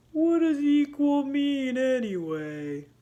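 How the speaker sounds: slow, plodding turtle voice; a hint of a sleepy old turtle. Box Turtle slowly asking what equal means.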